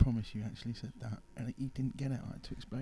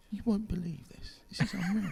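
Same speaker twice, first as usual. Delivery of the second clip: excited call; 8 semitones higher, 3 dB louder than usual. Retold or imitated. Roommate calling him to mirror.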